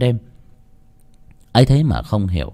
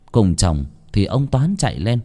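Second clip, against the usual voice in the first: usual speaking voice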